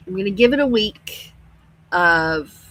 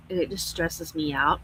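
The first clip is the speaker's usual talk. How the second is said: ordinary talk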